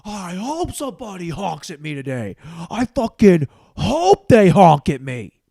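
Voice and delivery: imagined driver ranting, wanting someone to honk; angry driver imagined. Retold or imitated. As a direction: fume like a man itching for a fight. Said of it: "Was he like".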